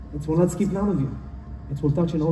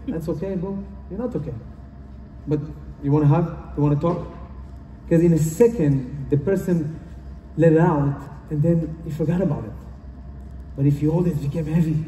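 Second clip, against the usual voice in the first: ordinary talk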